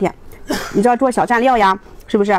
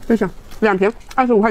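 talking between bites; speaking with food in mouth; mouth full, chewing. Real, not acted. Seen 2: Just bit food at -3s; cheeks full, chewing early on.

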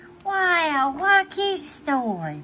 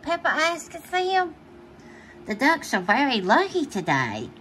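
ordinary delivery, nothing marked

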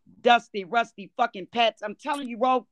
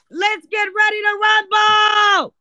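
loud, high, drawn-out hype call; ring-announcer shout; 7 semitones higher, 6 dB louder, slower than usual. Pretend. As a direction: shout it like opening a big fight, stretching it out. Show opener hype call.

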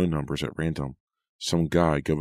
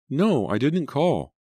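put-on high, drawn-out child's denial; slow, high-pitched whine; protesting little boy; 11 semitones higher, 4 dB louder, slower than usual. A narrator's reading. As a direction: insist like a wronged small child. Small boy denies calling.

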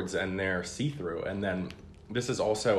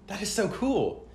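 excited; 12 semitones higher, 4 dB louder, slower than usual. Real, not acted. Reacting to flexing board.

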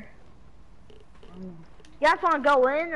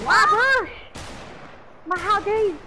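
raging gamer; 5 semitones higher than usual. Real, not acted. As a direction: yell it like a personal insult. Outraged about destroyed bush.

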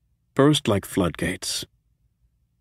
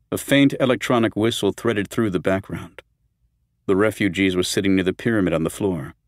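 plain reading voice

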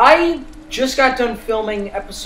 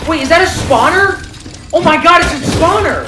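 shocked; 7 semitones higher, 7 dB louder than usual. Real, not acted. Sudden spawner appears, panic.

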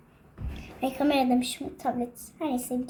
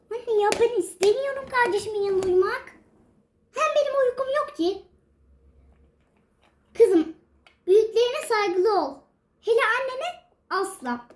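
put-on high, whining little girl voice, higher at times; whiny daughter; 6 semitones higher than usual. Daughter refusing to sleep beside her sibling, whiny. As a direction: sulk like a child who won't go to bed.

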